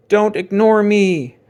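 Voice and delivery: high, loud, slow demand from the crayon; creepy crayon voice. Acted. The haunted crayon shouting at Jasper, loud and high. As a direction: let the crayon shriek its order.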